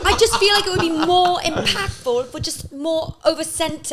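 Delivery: high, loud, pleading British politeness; British giving notes. Shown as a demonstration. British director asking for a move to centre, hammed-up polite British accent. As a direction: coax them in an exaggerated British accent. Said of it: "If a British person is giving you a note".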